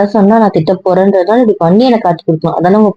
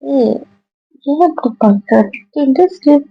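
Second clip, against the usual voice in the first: shocked protest; 6 semitones higher than usual. Acted. Wife shocked by husband's plan.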